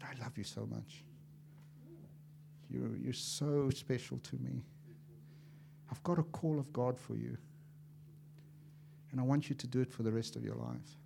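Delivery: God calling someone with love; tender God voice. Acted. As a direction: speak it like God to a beloved child. God's voice, tender.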